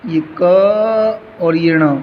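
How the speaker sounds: letters drawn out very slowly; slow spelling out. Shown as a demonstration. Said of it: "कैसे लिखोगे?". (अक्षर धीरे-धीरे बताना)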